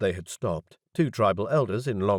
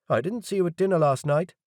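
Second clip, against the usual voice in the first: doubtful; 8 semitones higher, 3 dB louder than usual. A narrator's reading. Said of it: "his voice doubtful".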